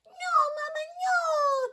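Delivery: high, loud little-duckling protest; scared ducklings crying out. A narrator's reading. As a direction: squeal a frightened refusal to Mama. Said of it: "they cried".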